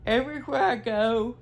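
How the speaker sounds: ordinary talk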